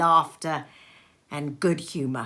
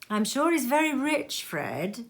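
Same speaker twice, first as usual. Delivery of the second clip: lightly higher, sly hinting; hinting niece; 7 semitones higher than usual. Acted. Niece hinting to husband Fred about wealth. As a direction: drop the hint teasingly. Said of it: "hinted Scrooge's niece".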